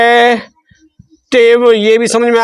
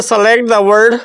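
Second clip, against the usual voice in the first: slow read-out; much slower than usual. Shown as a demonstration. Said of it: "ये कह रहा है".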